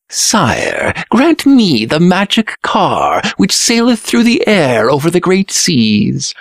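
high-pitched eager prince; Green Prince pleading. Acted. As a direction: ask the king in a high, ringing voice. Green Prince begs the king.

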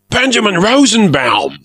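high, loud delighted cry; excited cry. A narrator's reading. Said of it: "the pirate cried".

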